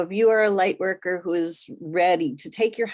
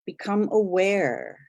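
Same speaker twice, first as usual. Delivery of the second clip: unhurried guide, letting each phrase settle; guided meditation; 6 semitones lower than usual. Real, not acted. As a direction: gently lead listeners inward, phrase by phrase. Leads a guided meditation journey.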